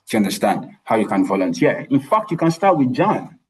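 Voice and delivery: ordinary delivery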